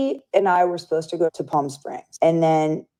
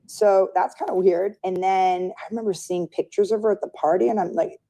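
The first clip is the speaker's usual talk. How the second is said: ordinary talk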